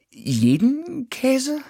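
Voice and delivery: high, slow, dazzled mouse; dazed mouse. Acted. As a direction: breathe it out in wonder.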